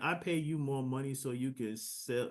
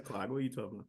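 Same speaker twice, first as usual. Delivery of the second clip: ordinary talk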